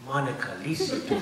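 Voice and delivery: put-on melodramatic plea in a Mexican accent; mock soap-opera Mexican accent; hammed-up Mexican accent. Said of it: "I've gone from that to".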